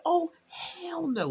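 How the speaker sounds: slow, high, drawn-out "Oh, hell no"; flat-out refuse it, dragging it out; emphatic refusal. Retold or imitated. To the husband, refusing the arrangement, drawn-out and high. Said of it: "I said".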